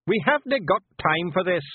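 loud, high impatient snap; snapped. Acted. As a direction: cut them off. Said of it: "snapped Rob Anybody".

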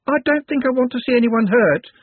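put-on light, timid young woman. A narrator's reading. Juliet voiced.

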